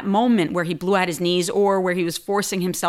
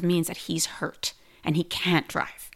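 ordinary delivery

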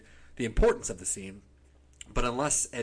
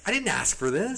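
mildly indignant protest, higher and louder; hammed-up grumble of an aggrieved fan; mock indignant; 9 semitones higher, 6 dB louder than usual. Viewer complaining about a week with [name], mock indignant.

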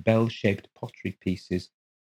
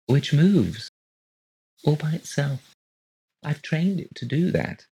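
creepy neighbour; 7 semitones higher than usual. A narrator's reading.

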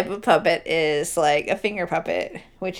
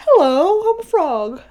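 high squeaky puppet voice; frog puppet voice; 10 semitones higher, 5 dB louder, slower than usual. Retold or imitated. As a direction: play a chirpy puppet frog. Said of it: "do a dance and say".

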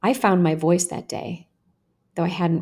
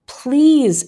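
louder, higher begging; desperate plea; 7 semitones higher, 8 dB louder than usual. A narrator's reading. Girl squirming under boys' grip, desperate plea. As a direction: beg them, drawn out and loud.

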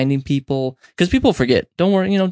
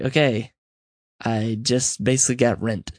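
unenthusiastic; 2 semitones lower, 3 dB quieter than usual. Retold or imitated. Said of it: "how unenthusiastic I was".

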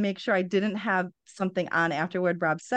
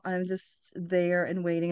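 usual speaking voice

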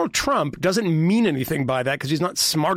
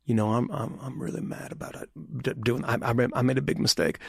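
softer, half-whispered mock confession; regretful voter; 4 semitones lower, 6 dB quieter than usual. Imitating a voter who regrets Trump, softer, half-whispered. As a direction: hush it like a sheepish regretful voter. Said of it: "and he's like".

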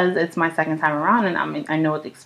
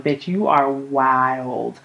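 mock disbelief; 4 semitones lower, much slower than usual. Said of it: "I was like".

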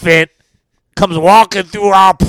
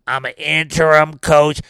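ordinary delivery

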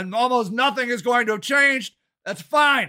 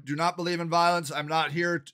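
ordinary talk

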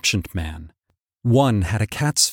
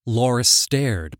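plain reading voice

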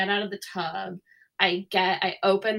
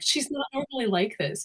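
higher-pitched mock excuse from a dog owner; laughing it off; 7 semitones higher than usual. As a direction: imitate someone brushing it off, pitched up. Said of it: "trying to laugh it off".

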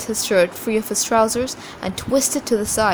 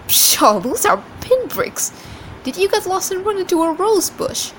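chuckling scoff; 11 semitones higher than usual. A narrator's reading. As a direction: scoff as if his bruises are nothing. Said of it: "then chuckled and said".

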